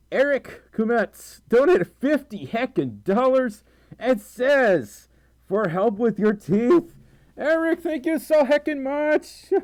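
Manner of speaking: high, loud, drawn-out excitement; thrilled gratitude. Real, not acted. Streamer thanking a fifty dollar donor, thrilled. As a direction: stretch the words, high with amazed delight.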